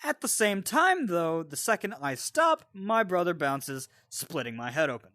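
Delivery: usual speaking voice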